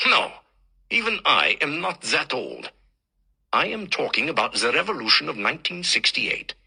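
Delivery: a hint of a lecturing German professor, German accent; lightly voiced old German teacher in German accent; thick German accent. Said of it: "speaks in a German accent so thick".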